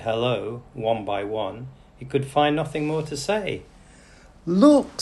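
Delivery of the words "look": high, loud excited cry; excited call. A narrator's reading. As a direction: point her out in wonder. Guest spots newcomer.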